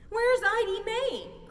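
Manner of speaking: high, loud, slow alarmed cry; a frantic mother calling out; panicked cry for the missing baby. A narrator's reading. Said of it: "cried Missus Tumbleweed".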